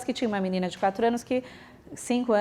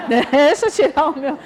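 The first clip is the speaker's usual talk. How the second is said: laughing; 2 semitones higher, 5 dB louder than usual. Retold or imitated. Director escaping, laughing.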